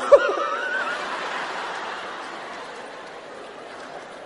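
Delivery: long, high, airy wheezing laugh; breathless laugh. Real, not acted. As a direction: laugh until the breath runs out. Reacting to the colleague's remark.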